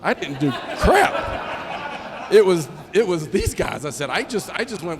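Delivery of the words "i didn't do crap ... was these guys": laughing correction. Real, not acted. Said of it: "I'm sorry".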